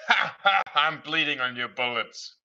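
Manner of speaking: psycho taunt opening on a loud, high cackle; yelling psycho. Acted. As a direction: laugh madly, then crow the line. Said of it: "hearing the psychos yell all kind of random".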